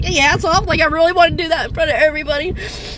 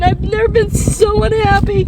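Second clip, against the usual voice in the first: ordinary delivery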